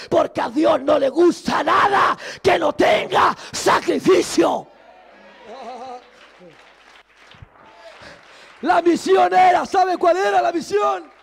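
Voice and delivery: preaching at full force. Acted. Preacher urging the congregation to praise God fully, shouting. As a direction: preach it out to the whole church.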